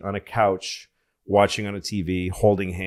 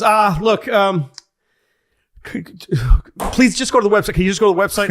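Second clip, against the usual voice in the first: higher, louder, harried pleading; frazzled staffer; 9 semitones higher, 5 dB louder than usual. Retold or imitated. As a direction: beg him to go away. Frazzled staffer begging caller.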